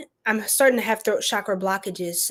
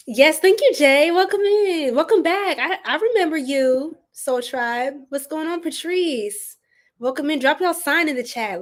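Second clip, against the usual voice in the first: excited greeting; 5 semitones higher than usual. Real, not acted. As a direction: greet everyone warmly and high. Greeting live viewers excitedly.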